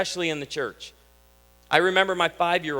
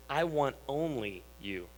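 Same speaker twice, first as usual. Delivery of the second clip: soft, earnest; 4 semitones lower, 7 dB quieter than usual. Acted. Exclusivity personified speaking.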